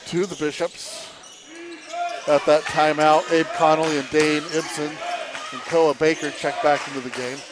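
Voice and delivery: fast, rising sports call; play-by-play. Acted. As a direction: call the action live, building. Live basketball play-by-play.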